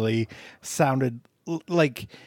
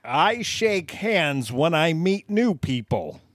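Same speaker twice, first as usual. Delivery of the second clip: higher, slow stilted mock alien; alien posing as human; 4 semitones higher, slower than usual. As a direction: proudly recite human habits like an alien. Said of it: "he talked like that".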